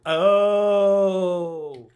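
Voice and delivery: sudden loud high oh; startled gasp of surprise; surprised. Real, not acted. Excited exclamation at alert.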